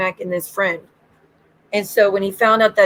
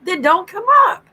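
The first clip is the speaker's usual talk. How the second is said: retort; 10 semitones higher than usual. Retold or imitated. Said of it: "And I'm like".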